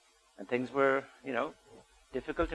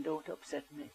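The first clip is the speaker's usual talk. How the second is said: softer, quietly restrained warning; a hushed, tight-lipped warning; nice and quiet; 8 dB quieter than usual. Shown as a demonstration. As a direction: say it softly, holding back a threat. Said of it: "it can be also nice and quiet".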